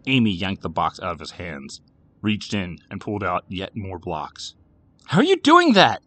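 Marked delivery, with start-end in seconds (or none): 5.1-6.0 high, loud burst of disbelief; sputtering disbelief. A narrator's reading. Boy baffled by his sister's blocks, sputtering in disbelief. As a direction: sputter it out, incredulous and raised. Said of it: "he sputtered in disbelief".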